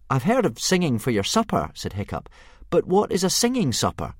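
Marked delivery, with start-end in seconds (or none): 0.1-1.7 put-on higher, bright boy; a boy bluffing bravely. Hiccup answers the dragon. 2.7-4.1 put-on higher, bright boy; a boy bluffing bravely. Hiccup answers the dragon.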